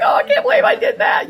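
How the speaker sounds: high, loud wail of self-reproach; distraught self-blame. Retold or imitated. Mom scolding herself after the wrong turn. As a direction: beat yourself up out loud. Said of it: "I'm like".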